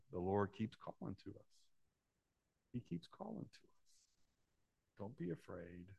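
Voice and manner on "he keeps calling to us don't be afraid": soft call. Real, not acted. Preacher softly voicing God's call.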